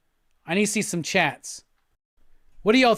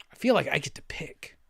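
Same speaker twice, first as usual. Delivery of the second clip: whisper; 6 semitones lower, 7 dB quieter, faster than usual. Real, not acted. Muttering to self while choosing song.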